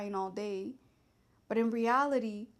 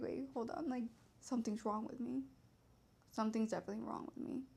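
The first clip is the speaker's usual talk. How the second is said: much softer, hushed dawning worry; quiet realization; 8 dB quieter, faster than usual. Retold or imitated. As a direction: murmur it as the truth sinks in. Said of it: "I was like".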